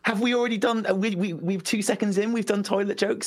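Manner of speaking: high-pitched playful incredulity; mock disbelief. Mock objection to early toilet jokes.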